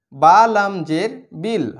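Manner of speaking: normal talking voice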